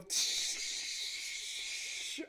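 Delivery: airy, helpless laughter; breathless laugh. Real, not acted. Recalling spraying the extinguisher, real helpless laughter. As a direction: laugh at how dumb it was.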